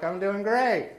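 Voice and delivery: high, loud upbeat answer; cheery reply. Retold or imitated. Pastor answers the church lady, high and cheery. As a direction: sing it out high and pleased.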